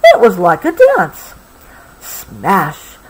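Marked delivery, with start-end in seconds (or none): none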